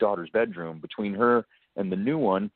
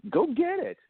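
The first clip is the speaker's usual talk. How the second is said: put-on high insistent girlfriend voice; voicing his date ordering him to get the snake; girlfriend's demand. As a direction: mimic her urgent high plea.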